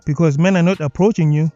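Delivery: ordinary talk, nothing marked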